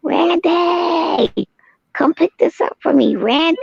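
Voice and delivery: high hollered orders to Randy; mock demanding wife. Mimicking her demanding Randy fetch things, high mock holler. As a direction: imitate her calling Randy for chores. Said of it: "She said".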